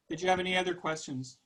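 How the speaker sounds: ordinary talk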